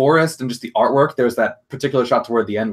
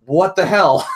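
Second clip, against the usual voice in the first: higher, louder baffled cry; baffled outburst; 8 semitones higher, 6 dB louder, much slower than usual. Retold or imitated. Villain baffled as her minions scatter, higher and louder. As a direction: blurt it out in disbelief. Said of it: "And she's like".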